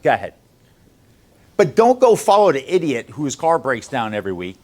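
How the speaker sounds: ordinary delivery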